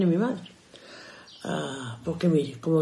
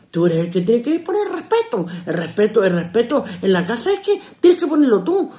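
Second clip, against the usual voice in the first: mother insisting; 4 semitones higher, 6 dB louder than usual. Retold or imitated. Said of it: "mother to father".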